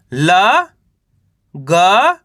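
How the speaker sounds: ल and ग each said alone with a pause after; syllable by syllable. Shown as a demonstration. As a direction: sound out ल, then ग, separately. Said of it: "मैं किस तरीके से एक अक्षर का उच्चारण करके". (ल और ग अलग-अलग, रुक-रुक कर)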